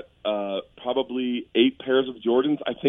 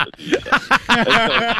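"a T V show" said slow, high and loud; drawn-out emphasis; 11 semitones higher, 6 dB louder, much slower than usual. Real, not acted. Mocking how big the show was, slow loud emphasis. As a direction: stretch out "a T V show" for the punch. Stressing a joke point.

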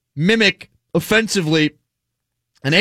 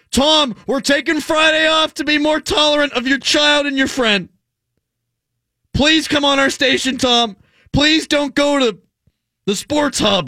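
higher, hammed-up grovelling to Tom; mock begging plea; 8 semitones higher than usual. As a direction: beg Tom like a desperate suitor. Mocking the station begging Brady.

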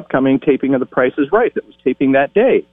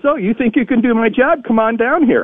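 high, playful announcer challenge; teasing announcer; 10 semitones higher than usual. Retold or imitated. Johnny Olson daring the eager teen, high playful announcer. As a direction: dare the kid with a grin in the voice. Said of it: "and he says".

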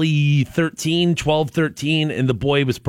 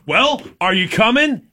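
impatient call; 8 semitones higher than usual. Retold or imitated. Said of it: "And I'm like".

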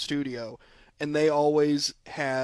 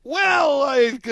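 laughing; 10 semitones higher, 8 dB louder than usual. Real, not acted. Laughing at embarrassing memory.

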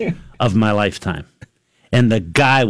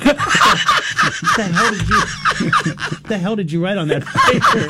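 imagined jury question through laughter; half-laughing mock disbelief; 8 semitones higher, slower than usual. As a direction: laugh while voicing the baffled jurors. Jury's imagined look, laughing.

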